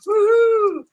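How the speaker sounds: slow, very high, loud sarcastic woo-hoo; mock cheer. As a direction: cheer like it's a big win, hammed-up. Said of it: "I'm like".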